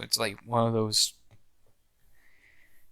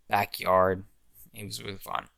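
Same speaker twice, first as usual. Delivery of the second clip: usual speaking voice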